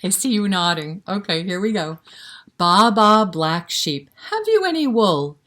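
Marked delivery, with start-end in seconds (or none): 2.6-5.4 loud, higher sing-song call to the sheep; loud high nursery rhyme call; rhyme recital. Acted. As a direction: belt out the bleating opening.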